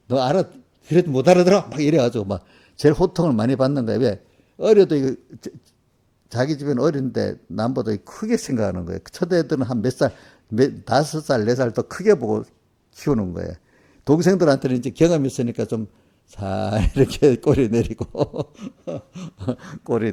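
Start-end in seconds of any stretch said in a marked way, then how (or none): 0.1-1.7 loud, high exasperated scolding; mother yelling at her first child in exasperation. Retold or imitated. 16.3-20.1 slow, chuckling through the words; amused slow telling with laughs; laughing. Real, not acted. Laughing, slow about tucking tail.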